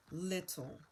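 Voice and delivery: ordinary delivery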